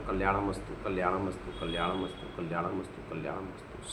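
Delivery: evenly repeated, lowering to a hush; murmured repeated blessing. Real, not acted. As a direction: let the benediction sink and die away. Repeated closing blessing, dying away.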